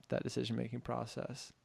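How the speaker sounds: ordinary talk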